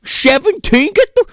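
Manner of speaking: high, loud squawk of disbelief; mock outraged yell. Frat guy ordering the underage girl out, mock outrage. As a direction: explode in hammed-up outrage.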